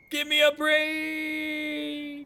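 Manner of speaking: long drawn-out high wail; exasperated cry. Acted. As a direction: howl it out in disbelief. Villain falls in own trap.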